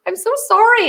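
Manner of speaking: high, loud gushing apology; mock apologetic employee. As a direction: act out staff apologising profusely. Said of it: "And they'll go".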